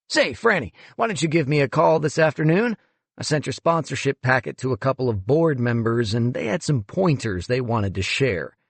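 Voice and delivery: breezy condescending boss on the phone; a hint of a smug museum director; smug executive.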